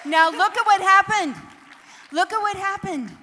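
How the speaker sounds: loud, high and thrilled; excited shout. Real, not acted. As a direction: hype the whole room up. Cheering the crowd on stage.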